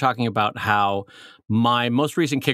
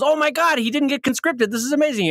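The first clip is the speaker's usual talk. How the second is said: high-pitched acted amazement; thrilled neighbor; 11 semitones higher than usual. Neighbor on son escaping the war, thrilled. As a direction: gush over the narrow escape.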